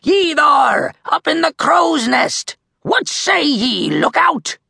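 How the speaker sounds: high, hailing pirate holler; pirate shout. A narrator's reading. Captain Red hailing the crow's nest, shouting. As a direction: shout up to someone far overhead. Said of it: "Captain Red gave a shout".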